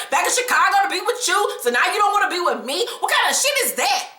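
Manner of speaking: imitating Jay's indignant rant; mock upset girlfriend. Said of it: "And Jay is like".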